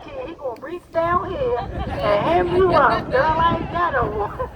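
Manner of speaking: hammed-up slow old uncle; booming tipsy uncle. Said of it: "I'm like".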